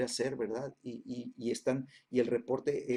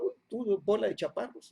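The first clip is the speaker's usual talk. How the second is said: mocking giants; 6 semitones higher than usual. Giants mock the Israelites.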